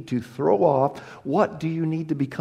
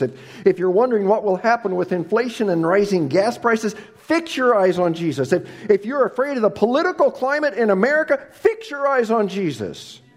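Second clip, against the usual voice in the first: loud and high, slowing on the climax; fiery preaching; 8 semitones higher, 5 dB louder than usual. Acted. Preacher urges worried listeners to look to Jesus, rousing. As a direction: build to a rousing close.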